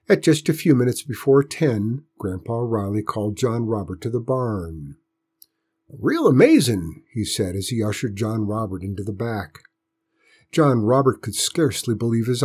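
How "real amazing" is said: put-on old farmer voice. A narrator's reading. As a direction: play the pleased old farmer. Grandpa voiced in story.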